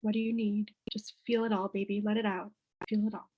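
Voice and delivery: put-on soft, tender calming words to a child; soothing reassurance. Shown as a demonstration. Mother soothing her daughter mid-tantrum, soft and tender. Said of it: "with Ella, I say".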